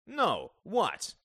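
loud, high, demanding retort; angry challenge. A narrator's reading. Father demanding his son explain himself, angry.

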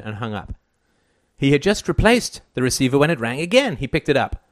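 usual speaking voice

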